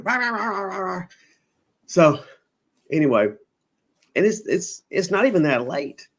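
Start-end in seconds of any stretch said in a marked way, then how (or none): 0.0-1.0 high, rapid mock yapping; imitated dog yapping. Storyteller imitating a shopkeeper's little dog, high yapping. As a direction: yap like a little dog going off. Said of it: "Had a little old dog in there screaming".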